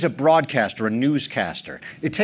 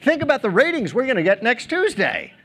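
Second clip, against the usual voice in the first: eager producer; 9 semitones higher than usual. Retold or imitated. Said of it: "steps forward and says".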